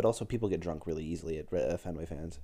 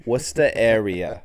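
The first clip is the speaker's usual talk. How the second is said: loud Boston "Wusta area"; emphatic Boston pronunciation; Boston accent; 9 dB louder than usual. Shown as a demonstration. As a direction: drop the r in Worcester, Boston style.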